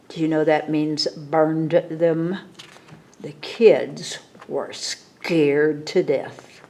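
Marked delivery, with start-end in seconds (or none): none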